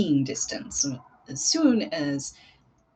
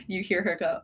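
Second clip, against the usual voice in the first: ordinary delivery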